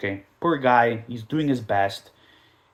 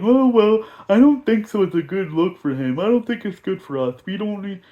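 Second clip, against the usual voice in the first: higher mock voice of doubting fans; mocking whiny fans; 6 semitones higher than usual. Mocking the doubting fans to his viewers, higher whiny voice. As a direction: whine like skeptical fans. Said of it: "And all you guys are like".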